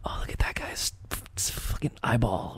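hushed, secretive aside; whisper. Acted. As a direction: whisper it so people watching won't hear. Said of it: "whisper something to Kayla".

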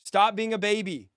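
ordinary talk

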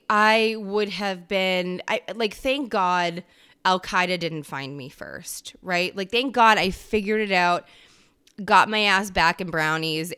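usual speaking voice

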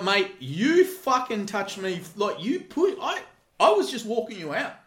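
yelling back at the crybaby; indignant shout. Retold or imitated. Bouncer shouting back at the crying drunk, loud and indignant. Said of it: "And I was like".

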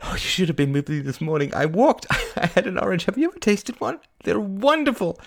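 high, giddy gushing with laughs; manic, high-pitched delight; giddy cry. Acted. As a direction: gush breakneck to a friend, giggling. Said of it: "she cried giddily".

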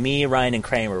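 usual speaking voice

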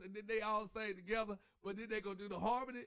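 ordinary talk, nothing marked

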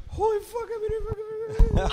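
high, loud disbelieving shriek; a young fan's loud, high squeal of disbelief; excited squeal. Retold or imitated. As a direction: squeal like a stunned fan. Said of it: "I sat at Grumpy's".

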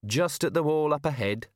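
lightly voiced, casual remark; easy, chatty character voice; conversational. A narrator's reading. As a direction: mention it as if in passing. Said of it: "said Tea Time conversationally".

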